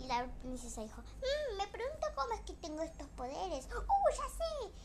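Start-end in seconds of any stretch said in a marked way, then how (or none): 1.0-4.7 much higher curious wondering, then laughing eureka; high princess voice. Acted. Princess puzzling over her powers, high voice ending in a laughing eureka. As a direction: wonder aloud in a high voice then burst out with the idea.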